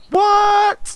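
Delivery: high-pitched, drawn-out "what?"; mock astonishment. Student mid street-math showdown, mock astonished. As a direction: squawk it high in hammed-up disbelief.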